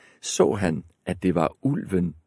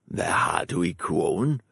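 sly soft wolf; wolf voice, softer; 2 semitones lower, 3 dB quieter than usual. A narrator's reading. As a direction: coax the girl slyly.

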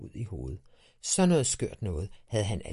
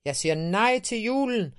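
much higher, louder angry yell; angry shout back; 11 semitones higher, 8 dB louder than usual. A narrator's reading. Boy angrily shouts back at his dad. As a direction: bark it back in defiance. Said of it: "råbte Tiberius argt tilbage".

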